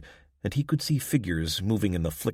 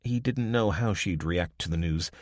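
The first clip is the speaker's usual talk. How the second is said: ordinary delivery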